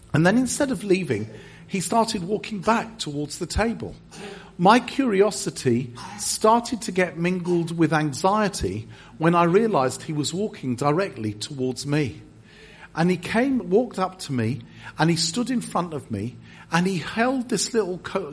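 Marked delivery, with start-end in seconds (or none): none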